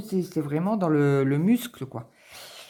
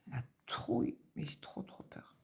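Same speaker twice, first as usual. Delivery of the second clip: soft breathy whisper; whispered fear; 2 semitones lower, 11 dB quieter than usual. Real, not acted. Confiding her fear to viewers in a whisper. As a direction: hush it, dread creeping in.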